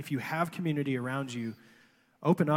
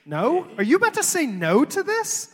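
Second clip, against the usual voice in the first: mock shock; 11 semitones higher, 7 dB louder than usual. Pastor teasing his colleague for refusing, mock shock. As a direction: pitch shoots up in playful disbelief.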